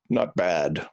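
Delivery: low, unimpressed brush-off; dismissive "not bad". Parent brushing off a child's first drawing, unimpressed.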